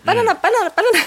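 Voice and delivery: excited. Real, not acted. Excited banana chant.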